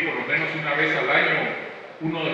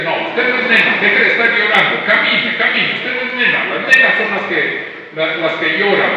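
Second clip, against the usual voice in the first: stern scolding father; 3 semitones higher, 7 dB louder than usual. Retold or imitated. Father scolding crying son.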